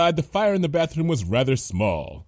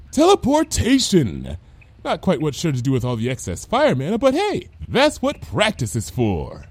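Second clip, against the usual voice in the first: Lyra, triumphant; 6 semitones higher than usual. Acted. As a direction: announce it proudly, stretching the first word.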